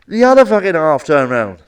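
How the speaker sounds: ordinary delivery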